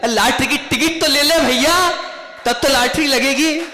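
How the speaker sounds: high-pitched, exasperated pleading; exasperated retort. Acted. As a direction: raise the voice high, scolding a pestering devotee. God scolds the lottery seeker.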